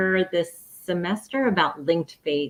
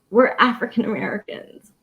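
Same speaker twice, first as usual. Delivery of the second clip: fighting back tears mid-sentence; emotional, near tears; 3 semitones higher than usual. Real, not acted. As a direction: voice catching, pitched up with feeling. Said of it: "I'm sorry, I'm getting emotional".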